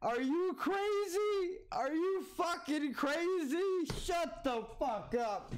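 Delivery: very high, slow shrieking disbelief; squealing mock outrage. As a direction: screech it like you can't believe it.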